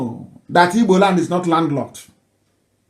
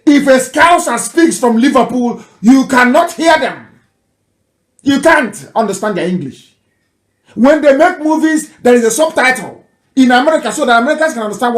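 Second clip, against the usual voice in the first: higher and louder, fired up; animated lecturing; 6 semitones higher, 4 dB louder than usual. Real, not acted. Commentator explaining UK accents to listeners, animated. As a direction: drive the point home with energy.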